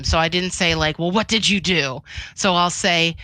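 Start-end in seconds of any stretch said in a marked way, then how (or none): none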